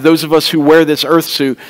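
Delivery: ordinary talk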